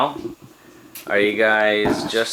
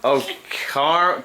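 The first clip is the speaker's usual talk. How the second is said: ordinary delivery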